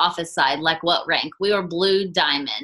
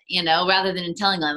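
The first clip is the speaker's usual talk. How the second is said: normal talking voice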